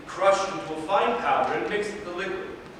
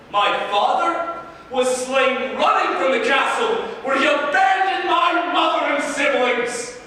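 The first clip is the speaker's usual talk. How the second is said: higher, bitter tirade; furious rant; 4 semitones higher than usual. Acted. Piero raging at Reyna about his cowardly family, angry. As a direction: spit out the hatred for his family.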